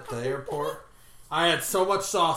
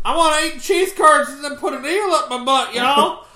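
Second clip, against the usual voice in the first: high, loud hammed-up Southern; Southern accent; 10 semitones higher, 11 dB louder than usual. Host wanting curds and eel, exaggerated Southern accent. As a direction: holler it like a mock Southerner. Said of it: "much stronger Southern accents".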